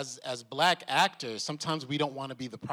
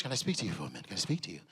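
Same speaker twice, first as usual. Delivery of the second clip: low soft Irish lilt; 8 semitones lower, 7 dB quieter, much faster than usual. Retold or imitated. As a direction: lower and softer, confidential request. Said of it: "soft, deep Irish lilt".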